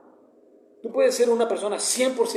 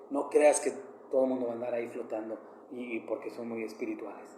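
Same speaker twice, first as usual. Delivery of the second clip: soft, lower voice of a loving mother; a hint of his late mother's soft voice; mother's tender advice; 6 semitones lower, 8 dB quieter than usual. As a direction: pass on a mother's gentle warning, hushed. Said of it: "Así me lo dijo mi madre".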